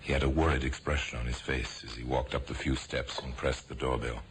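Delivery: normal talking voice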